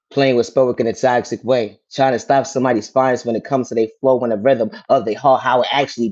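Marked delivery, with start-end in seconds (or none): none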